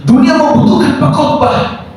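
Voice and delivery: high, ringing pulpit cry; full-force preaching. Real, not acted. Preacher proclaiming scripture and a charge to the church, full-force preaching. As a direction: proclaim the verse, each phrase launched high and falling.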